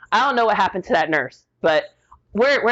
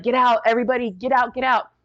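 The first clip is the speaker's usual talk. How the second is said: urgent order; 5 semitones higher, faster than usual. Retold or imitated. Ordering relatives out as husband crashes.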